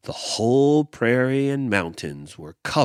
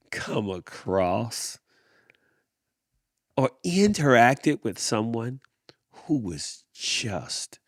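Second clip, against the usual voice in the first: plain reading voice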